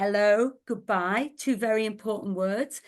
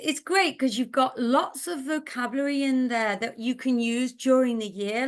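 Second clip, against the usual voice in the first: usual speaking voice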